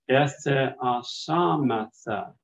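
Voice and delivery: "Ethe-asamatha" drawn out slowly syllable by syllable; slow syllabified word. Shown as a demonstration. Teacher models six-syllable Greek word, slow. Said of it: "a six-syllable word".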